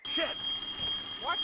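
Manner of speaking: loud sudden alarm; startled curse. Acted. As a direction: jolt of fright.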